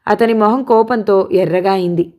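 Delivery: usual speaking voice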